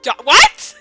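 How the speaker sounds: plain reading voice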